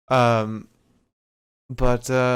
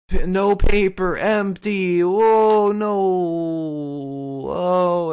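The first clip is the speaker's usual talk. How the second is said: loud, higher pissed-off wail; big loud put-on dismay; mock outrage; 6 semitones higher, 4 dB louder, much slower than usual. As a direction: draw out a hammy, indignant oh no. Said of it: "like, pissed".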